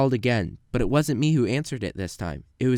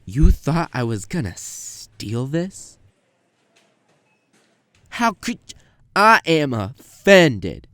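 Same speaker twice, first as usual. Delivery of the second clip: slow, put-on wounded pride; mock indignation, drawn out; mock offended; 2 semitones higher, 6 dB louder, slower than usual. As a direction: play the innocent, insulted customer. Shoplifter feigning offense.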